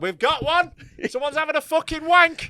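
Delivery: loud, high excited alarm; hammed-up excited office worker; mock Google staff. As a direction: pretend Google employees spotting a user, excited.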